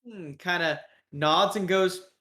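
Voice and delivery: ordinary talk, nothing marked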